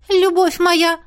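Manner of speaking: high, hesitant ghost voice; unsure ghost. A narrator's reading. Ghost answers her summoner, high and unsure. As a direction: add it uncertainly, pitched high. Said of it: "неуверенно добавила".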